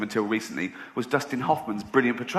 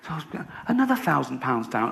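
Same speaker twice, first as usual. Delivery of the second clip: ordinary talk, nothing marked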